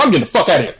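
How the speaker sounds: normal talking voice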